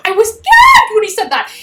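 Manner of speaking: high, loud gasp of disbelief; shocked exclamation. Real, not acted. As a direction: blurt it out in shock.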